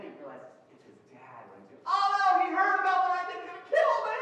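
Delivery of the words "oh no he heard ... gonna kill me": panicked high yell, much louder; freaking out. Retold or imitated. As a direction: shriek it like he's about to be caught. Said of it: "imagine the boy freaking out".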